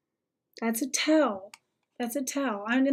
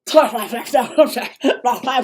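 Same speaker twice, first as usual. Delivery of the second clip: loud laugh; 7 dB louder than usual. Real, not acted. Streamer hearing the guest runs a parent support group, loud laugh. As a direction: burst of loud disbelieving laughter. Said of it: "believe it or not".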